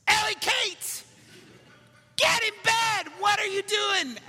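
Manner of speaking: very high, loud exasperated dad shout; mock nicest voice, yelling. Dad to daughter still out of bed, full exasperated yell. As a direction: bellow it at the top of your voice, comically fed up. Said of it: "in my nicest voice".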